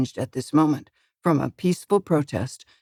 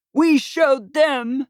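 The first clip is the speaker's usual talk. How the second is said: triumphant yell; 8 semitones higher, much slower than usual. A narrator's reading. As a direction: gloat at the whole street. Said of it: "Ralph yells".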